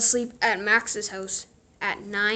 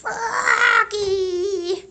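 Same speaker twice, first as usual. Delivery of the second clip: high, loud drawn-out call; mother calling up to her son; 10 semitones higher, 8 dB louder than usual. A narrator's reading. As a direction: call him from downstairs, sing-song. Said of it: "called his mother".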